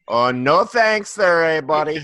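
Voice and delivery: put-on a jail inmate declining escape in a Minnesota accent. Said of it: "Is this the Netherlands or Minnesota?".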